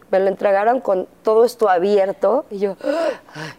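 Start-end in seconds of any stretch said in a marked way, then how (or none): 2.8-3.3 sharp shocked gasp of breath; retold gasp. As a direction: gasp at the sight of blood. Seeing her son's bleeding head.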